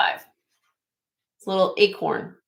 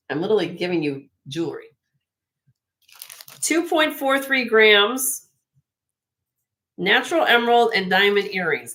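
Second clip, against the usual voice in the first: ordinary delivery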